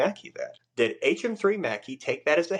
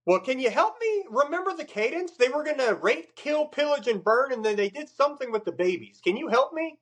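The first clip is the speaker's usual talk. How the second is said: sarcastic retort. Retold or imitated. Sarcastic comeback to chief at hearing.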